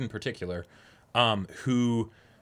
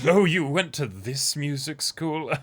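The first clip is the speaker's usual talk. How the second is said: mocking snob; 5 semitones higher, 4 dB louder, slower than usual. Co-host mocking Ugetsu's snobbery.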